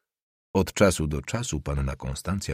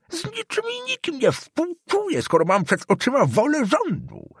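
put-on much higher, rigid old official; old councillor declaring loyalty to the government, much higher; haughty old councillor. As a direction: proclaim it like a servile old bureaucrat.

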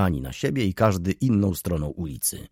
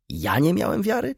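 zdziwione pytanie; 11 semitones higher than usual. Acted. Narrator protests to old lady.

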